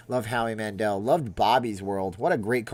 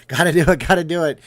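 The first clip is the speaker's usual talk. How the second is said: loud, high, compulsive repeating; mock frantic; 5 semitones higher, 10 dB louder, faster than usual. Streamer joking about scrubbing off germs, mock frantic shout. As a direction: play a frantic germaphobe, raised and loud.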